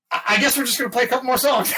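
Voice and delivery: high, loud and fast stage announcement; Rob's rushed announcement. Retold or imitated. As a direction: rattle it off cheekily over the mic. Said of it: "And Rob goes".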